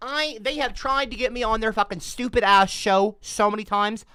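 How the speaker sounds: ordinary talk, nothing marked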